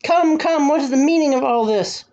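high, indignant royal voice; enraged demand. A narrator's reading. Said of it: "his face purple with rage".

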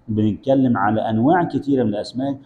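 ordinary talk, nothing marked

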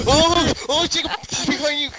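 high, frantic warning cry. Real, not acted. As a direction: warn them in a panic. Warning friend of pursuer.

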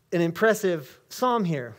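ordinary talk

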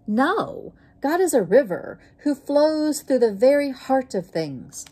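high, loud booming proclamation; a waterfall thundering its answer. A narrator's reading. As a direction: boom it out like a waterfall. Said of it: "thundered the waterfall".

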